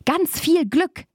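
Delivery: high, drawn-out urging call; sing-out exclamation, slowing and rising high; eager calling out. A narrator's reading. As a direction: urge them on in a high, stretched voice. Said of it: "rief Babe".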